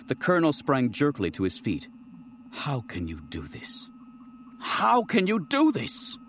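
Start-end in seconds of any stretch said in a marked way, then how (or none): 2.5-3.9 dropped low and much softer, slow; stunned murmur before the outburst; hushed disbelief. Acted. Colonel's anguished repeated question. 4.6-6.2 jumps much higher, strained and slow; a desperate high plea after the hushed first take; anguished outcry. Acted. Colonel's anguished repeated question.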